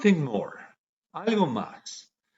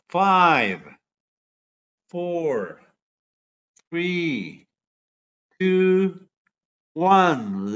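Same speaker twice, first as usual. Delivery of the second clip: countdown; 5 semitones higher, 4 dB louder, much slower than usual. Acted. Countdown before checking answers.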